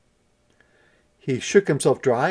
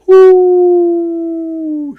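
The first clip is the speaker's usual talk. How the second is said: very high, loud screeching hoot; angry owl screech; 15 dB louder than usual. A narrator's reading. Angry owl screeching after Little Red, loud. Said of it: "screeched the owl angrily".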